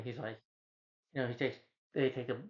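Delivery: ordinary talk, nothing marked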